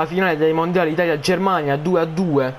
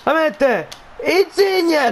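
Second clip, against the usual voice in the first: excited goal call; 7 semitones higher than usual. Real, not acted. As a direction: scream the name as it goes in. Telecronaca azione, urlo. (urlo da telecronista)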